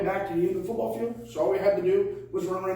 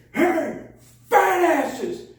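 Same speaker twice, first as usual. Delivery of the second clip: high, loud drawn-out holler; coach's shout; 10 semitones higher, 6 dB louder, much slower than usual. Retold or imitated. Coach calling cheating linemen back, imitated shout.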